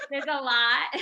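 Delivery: amused laugh into the words. Real, not acted. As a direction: laugh then say it with a chuckle. Laughs along with guest.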